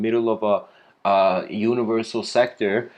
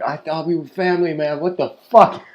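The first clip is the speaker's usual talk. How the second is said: hammed-up indignant protest, rushed then punched louder; hurt outburst; 3 semitones higher, 4 dB louder, faster than usual. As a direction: act out a betrayed friend, exploding on the swear. Scorpion protests to departing frog.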